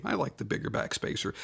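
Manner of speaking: a quiet, disappointed whisper to the viewer; whispered aside. Real, not acted. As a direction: whisper it to yourself like a private complaint. Wistful aside about the spacer.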